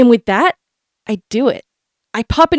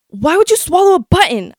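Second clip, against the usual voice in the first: dad shouting; 7 semitones higher than usual. Retold or imitated. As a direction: shout it at the kid in disbelief. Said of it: "I hear someone shout".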